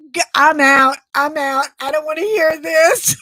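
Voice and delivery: imitating April backing away in disgust; mock disgusted friend. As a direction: play the grossed-out friend bowing out. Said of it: "April's like".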